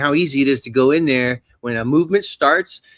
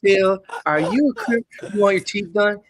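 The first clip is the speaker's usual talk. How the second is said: higher, teasing offer; higher mock husband voice; mock Phil offering; 7 semitones higher than usual. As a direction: dangle the next surgery like a bribe. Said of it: "he's like".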